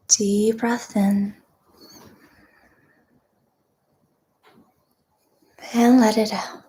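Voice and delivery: guided meditation. Real, not acted. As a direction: guide them gently into deep breathing, unhurried.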